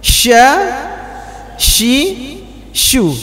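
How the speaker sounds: sya shi shu with strong rushing air; airy shin. Shown as a demonstration. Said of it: "kuat sekali anginnya".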